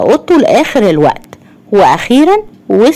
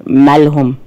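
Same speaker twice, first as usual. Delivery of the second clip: lightly voiced man, lower pitch; صوت رجل خفيف; 6 semitones lower, slower than usual. A narrator's reading. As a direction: drop lower for the man's question. Narrator voices man in dialogue. (صوت راجل واطي شوية بيسأل)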